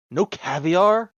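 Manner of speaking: high, drawn-out pampered scorn; haughty star, disappointed by the meal; spoiled disdain. Acted. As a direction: sniff at it like a pampered celebrity.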